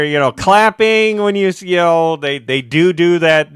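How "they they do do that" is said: normal talking voice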